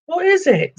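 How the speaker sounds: a curious troll calling out. Acted. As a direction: trolls crowding round in a high voice. Trolls ask together.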